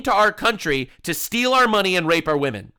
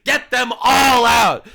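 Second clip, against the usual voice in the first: emphatic demand; 3 semitones higher, 6 dB louder, slower than usual. Real, not acted. Ranting demand for deportations.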